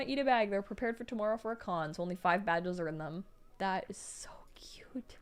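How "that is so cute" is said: breathy whispered "cute" reaction, slow. Real, not acted. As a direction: whisper it in awe.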